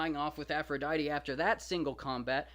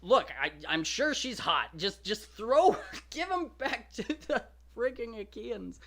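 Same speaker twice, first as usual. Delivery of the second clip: higher, louder exasperated plea; fed-up Trojan; 6 semitones higher, 5 dB louder than usual. Retold or imitated. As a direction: beg them like you're sick of this war. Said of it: "The one guy says".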